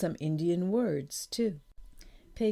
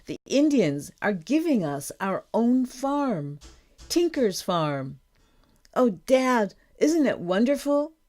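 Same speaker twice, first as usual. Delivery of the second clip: loud, lifted, building delight; joyful excitement; 5 semitones higher, 7 dB louder than usual. A narrator's reading. Daughter telling Dad about their own farm, joyful. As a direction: announce it happily, rising in pitch.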